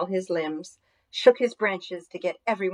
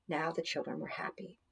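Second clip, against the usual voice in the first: ordinary delivery, nothing marked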